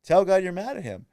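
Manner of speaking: a loud, emphatic friend saying it; friend's loud urging. Retold or imitated. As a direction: urge it loudly like a blunt friend. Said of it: "And he's like".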